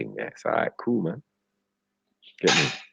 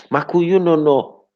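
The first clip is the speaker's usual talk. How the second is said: loud, high mock Jamaican accent; squealed parody of a Jamaican accent; bad Jamaican accent; 12 semitones higher, 5 dB louder than usual. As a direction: overdo a fake Jamaican accent. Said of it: "It was, like, terrible".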